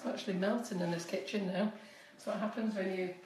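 ordinary talk

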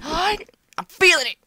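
building hype. Real, not acted.

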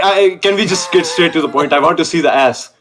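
ordinary talk

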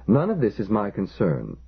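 ordinary delivery

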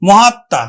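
মহাত্মা said as mohatta, with the double t and a nasal touch; teacher modelling মহাত্মা; pronunciation demo. Shown as a demonstration. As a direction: say মহাত্মা with a doubled t, a little through the nose. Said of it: "উচ্চারণ হলো কিন্তু".

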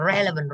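"Relevant" with a strong opening "Re"; stress on first syllable. Shown as a demonstration. Teacher settling the answer for class, "Relevant" stressed on "Re". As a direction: land firmly on "Re" in "Relevant", then drop away. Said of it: "trọng âm rơi vào chính nó rồi".

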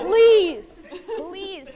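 loud, drawn-out laughing plea; exaggerated amused begging. Real, not acted. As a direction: beg loudly through laughter. Laughing plea after funeral remark.

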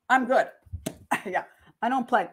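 ordinary delivery

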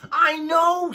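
high, loud, delighted squeal of a reply; cheerful little lamb. Acted. Wilbur the lamb answering his parents, high and gleeful. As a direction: chirp it like a proud young sheep.

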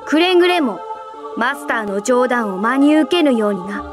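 loud, firm caution; stern warning. Acted. Superior cautioning about master's jokes, stern warning. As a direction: warn them with authority.

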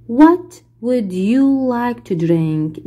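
each English word spelled out slowly for the class; very slow model reading. Shown as a demonstration. Teacher playing flight attendant, very slow model question. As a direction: read it slowly so pupils can repeat. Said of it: "واحد بيكون هو المضيف للطيران".

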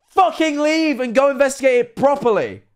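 high-pitched, exasperated rant; worked-up, high-pitched scolding; exasperated outburst. Real, not acted. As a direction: burst out in exasperation at the character. Exasperated advice to character.

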